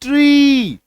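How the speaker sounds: ट्री with a long, high ee; forceful long vowel. Shown as a demonstration. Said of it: "थोड़ा हम लोग उच्चारण क्या कर रहे हैं".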